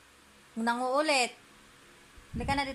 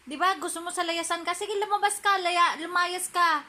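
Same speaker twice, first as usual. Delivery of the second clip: much higher, sing-song pretend telling-off; mock scolding; 8 semitones higher than usual. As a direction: shoo them away in a put-on high voice. Shooing the pet away, scolding. (pinapalayas ang alaga sa mataas na boses)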